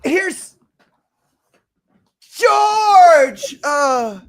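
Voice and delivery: hammed-up high yell, building to a long loud cry; imitated wailing shout. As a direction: build to a huge high mock wail. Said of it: "that's what it was like".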